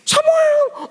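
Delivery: piercing falsetto shout, mimicking mum; shrill mother's call. Mother searching for her lost son, shrill imitated shout.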